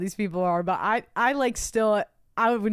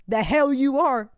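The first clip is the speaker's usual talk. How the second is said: mock indignant, slow and a bit louder; defiant retort; 4 semitones higher, 4 dB louder, slower than usual. Kids refusing the boy a seat, mock defiant. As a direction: drag it out as a playground challenge. Acts kids' quarrel over the spot.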